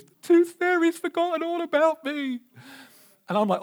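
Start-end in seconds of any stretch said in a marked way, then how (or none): 0.0-2.4 put-on high wailing little girl voice; child's voice, pitched high and upset; tearful child. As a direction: mimic a crying six-year-old. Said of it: "comes down in floods of tears".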